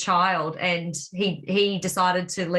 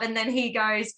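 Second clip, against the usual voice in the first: usual speaking voice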